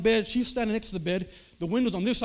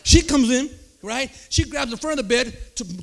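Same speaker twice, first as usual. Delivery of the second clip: ordinary talk, nothing marked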